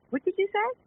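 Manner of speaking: stunned mom; shocked question. Retold or imitated. Mom reacting, shocked at son.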